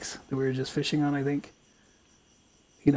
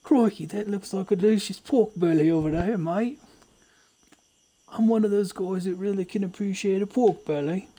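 pitched-up mock Aussie, every line tagged with mate; Australian accent; 5 semitones higher than usual. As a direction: play a jokey Australian bushman. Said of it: "Accents are too easy".

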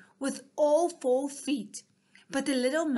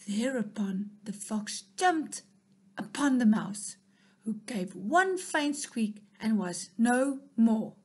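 plain reading voice

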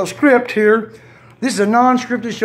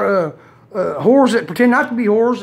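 ordinary delivery

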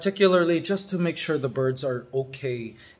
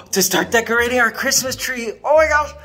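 high, louder gushing excitement; thrilled at finally having a real Christmas tree; excited; 7 semitones higher, 4 dB louder than usual. Real, not acted.

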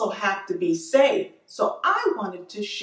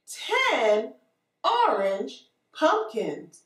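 put-on reading to a toddler; much slower than usual. Teacher reading to small children.

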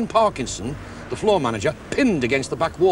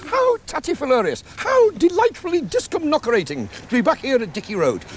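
put-on much higher, squeaky comic voice greeting kids; comic character voice; 13 semitones higher, 3 dB louder than usual. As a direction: bounce through the silly words like a cartoon.

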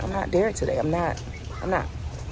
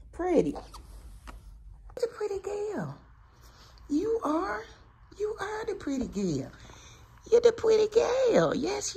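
much higher sing-song pet voice; doggy baby talk; 6 dB quieter than usual. Acted. Owner praising her dressed-up dog, high cooing baby talk.

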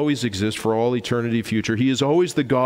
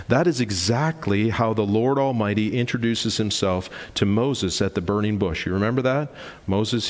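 ordinary talk

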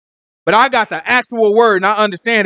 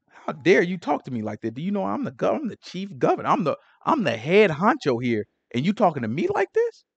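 softer, swinging pitch, mock indignation; affronted governor; 8 dB quieter than usual. Pashur answering Jeremiah who dared talk to him, affronted. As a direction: play a hint of an offended head honcho. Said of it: "He couldn't set his emotions aside".

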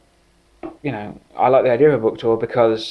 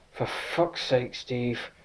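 mock exasperation; 8 dB quieter, much slower than usual. [name] exasperated at Steve's confession.

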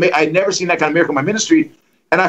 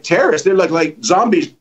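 ordinary delivery

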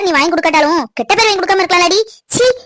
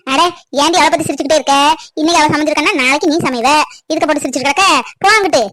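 ordinary talk, nothing marked

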